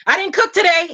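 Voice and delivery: high worried confession. Retold or imitated.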